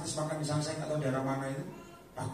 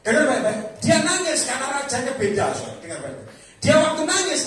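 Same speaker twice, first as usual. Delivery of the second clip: loud, high-pitched sermon delivery; forceful, raised preacher's voice; fired-up preaching; 8 semitones higher, 10 dB louder than usual. Real, not acted. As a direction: drive the point home to the congregation at full force.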